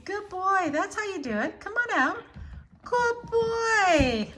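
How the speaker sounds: high, loud praise; excited praise. Real, not acted. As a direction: cheer the cat on. Praising cat emerging.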